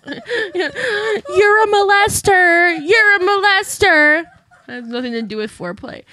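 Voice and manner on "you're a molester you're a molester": high, drawn-out, laughing holler; mock accusing shout. Host jeering at absent Josh Duggar, mock accusing shout. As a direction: wail the accusation slowly, loud and high.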